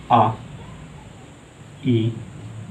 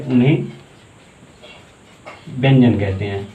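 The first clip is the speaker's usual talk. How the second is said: note dictation. Shown as a demonstration. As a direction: give it out bit by bit so they can write. Dictating definition to students.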